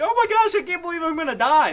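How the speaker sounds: very high, loud squeal; mock panic. Vlogger imitating Deadpool facing death, high loud squeal. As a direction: wail it like comic doom. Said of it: "he was like".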